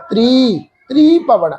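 ਤ੍ਰੀ Bhavan with a long "tree"; mistaken long EE. Shown as a demonstration. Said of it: "ਤ੍ਰੀ ਭਵਨ ਨਹੀਂ pronounce ਕਰਨਾ". (ਤ੍ਰੀ ਨੂੰ ਬਿਹਾਰੀ ਨਾਲ ਲੰਮਾ)

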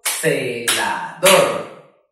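"Celador" very slow and low, ce, la, dor; three voice beats. Shown as a demonstration. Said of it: "La dividimos en tres sílabas o golpes de voz". ("Celador" muy lento y grave, en tres golpes)

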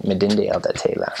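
Elda Taylor in a Twi accent, R dropped; Ghanaian accent. Shown as a demonstration. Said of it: "say it sort of in their accent".

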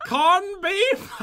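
put-on a high, giggly stab at an Irish accent; attempted Irish accent. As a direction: fumble a goofy Irish accent through laughter. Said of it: "Give us your best Irish accent".

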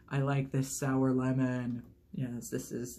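normal talking voice

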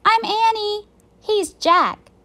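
high, loud calling-out; friendly yell. A narrator's reading. Girl introduces herself to an octopus, friendly yell. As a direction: call out introductions at high pitch. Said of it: "She yelled to the octopus".

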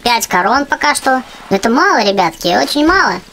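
usual speaking voice

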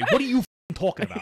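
mock angry outburst. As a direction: ham up a furious what are you talking about. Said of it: "you don't even say that. You say".

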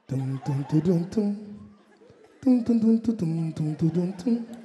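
low mouthed drum rhythm dun dun; beatbox the slow drum pattern quietly; imitated drumbeat. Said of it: "This is what I was hearing".

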